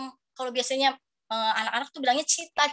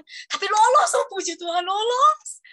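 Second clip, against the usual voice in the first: joyful relief; 8 semitones higher, 6 dB louder, slower than usual. Real, not acted. Relief at passing the test.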